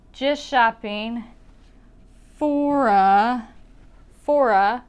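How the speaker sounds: "for a" slowly linked into one word; connected speech demo. Shown as a demonstration. Teacher shows students what will connect, linked speech. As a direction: stretch "for a" out as one smooth word. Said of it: "So what will I connect?".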